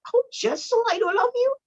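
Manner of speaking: high, fast, incredulous mock man's voice; man walks in on crying wife, high baffled squeal; baffled husband. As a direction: act stunned at her tears. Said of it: "the men is like".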